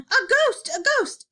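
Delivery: high, loud panicked cry; yelling. A narrator's reading. Crowd seeing an empty gown float by, panicked yell. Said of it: "with everybody yelling".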